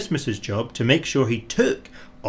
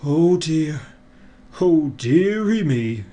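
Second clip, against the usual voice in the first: mild dismayed Father Christmas; lightly voiced sigh of worry; 4 semitones higher, 4 dB louder, slower than usual. A narrator's reading. Father Christmas stuck in snow, mildly dismayed. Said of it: "he said out loud, and sighed".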